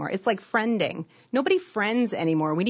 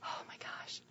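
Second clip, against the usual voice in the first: breathy amused whisper; 21 dB quieter than usual. Real, not acted. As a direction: whisper it in disbelief. Reacting to emotional support goat.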